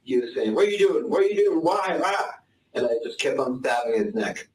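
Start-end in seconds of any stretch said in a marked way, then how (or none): 0.5-2.3 put-on old man voice. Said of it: "in his old man voice".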